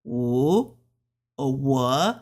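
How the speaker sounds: "wo" low, then rising, Mandarin third tone. Shown as a demonstration. Teaching learners the tones, "wo" in low dipping third tone. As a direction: dip "wo" low and swing it up. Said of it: "Third tone".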